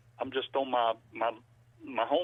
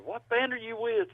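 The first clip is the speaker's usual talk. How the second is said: higher-pitched quoted question; a hint of an inquisitive girl; imitated curious girl; 10 semitones higher than usual. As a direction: lightly voice a girl asking, pitched up. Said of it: "One of the girls asked".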